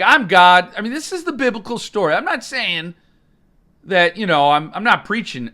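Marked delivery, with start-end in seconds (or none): 0.0-0.7 mock proud Lucifer. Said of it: "He's like".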